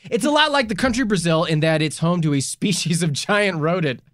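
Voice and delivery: normal talking voice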